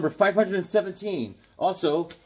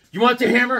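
fed-up taunt; 9 semitones higher, 9 dB louder, faster than usual. Retold or imitated. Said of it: "I was just like".

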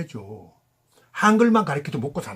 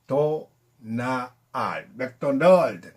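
drawn out very slowly, each sound held; slow drawn-out demo; 3 semitones lower, much slower than usual. Shown as a demonstration. Teacher slowly showing a pronunciation to students. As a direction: stretch 도나알 out long and slow. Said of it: "천천히 할게요".

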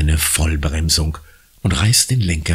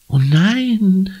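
high cry of dismay. A narrator's reading. Anna cries out at the new premiere date. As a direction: call out in shock. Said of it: "ruft Anna".

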